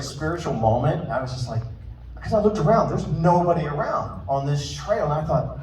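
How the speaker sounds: normal talking voice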